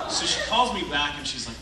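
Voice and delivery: usual speaking voice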